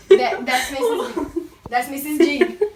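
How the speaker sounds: giggling, slowed by laughter; laughing while saying it; giggly. Acted. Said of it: "Stop laughing".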